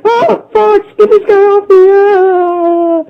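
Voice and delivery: very high, loud panicked squeal; squeaky cry for help. Acted. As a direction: shriek for rescue like a frantic toad. Slippy cries for help.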